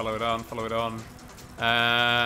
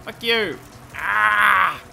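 loud, high outburst; frustrated yell; 6 semitones higher, 7 dB louder, much slower than usual. Real, not acted. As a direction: howl it as you get passed. Getting overtaken in race.

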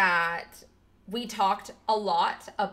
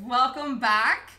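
usual speaking voice

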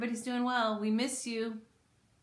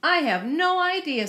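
high-pitched mother-bear reply; Mama Bear; 9 semitones higher, 4 dB louder than usual. Acted. Mama Bear answering her cub's question, high-pitched voice. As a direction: lift the pitch, bemused. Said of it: "said Mama Bear".

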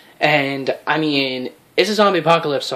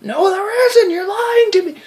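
much higher, louder crying shout; crying accusation; 4 dB louder than usual. Acted. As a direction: yell through tears at the friend who lied. Bitten friend accuses the liar.

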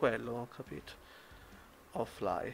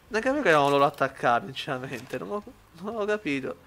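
usual speaking voice

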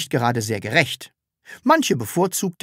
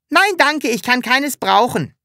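much higher, airy generous decline; magnanimous; 11 semitones higher, 4 dB louder than usual. A narrator's reading. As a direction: graciously refuse. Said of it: "sagte das Sams großmütig".

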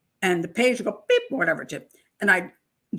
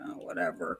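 dismissive mumble; 3 semitones lower, 12 dB quieter than usual. Retold or imitated. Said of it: "I'm like".

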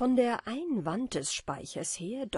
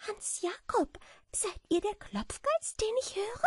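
high little bat voice calling across the attic; calling out, smiling; 13 semitones higher than usual. A narrator's reading. Bat calls to the beetle in the wardrobe, high and friendly. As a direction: call out brightly in a tiny high voice. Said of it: "dann lächelte sie und rief".

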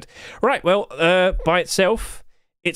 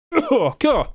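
running out of air; 9 semitones higher than usual. Real, not acted. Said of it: "I'm running out of breath".

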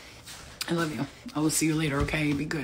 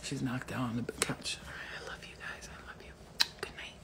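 soft whispered goodnight, much softer; whispering; 5 semitones lower, 12 dB quieter than usual. Real, not acted. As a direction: whisper so the sleeping mom won't wake. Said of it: "My mom's sleeping".

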